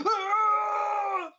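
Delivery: high, loud charging scream; battle cry. Acted. Crazed Viking charging the player with a loud scream. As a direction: let out a sudden attacking yell.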